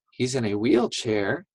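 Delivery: usual speaking voice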